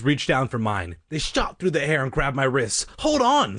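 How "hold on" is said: high, loud shout to hang on; urgent cry. A narrator's reading. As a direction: cry out to someone falling. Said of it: "Sorry cried".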